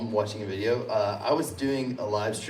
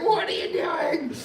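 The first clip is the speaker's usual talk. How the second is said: very high, slow mock-incredulous question; imitated baffled dad; slower than usual. Dad texting son about the livestream outfit, mock baffled. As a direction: draw it out in amused disbelief. Dad's reaction to son's silly outfit.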